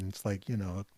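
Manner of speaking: ordinary talk, nothing marked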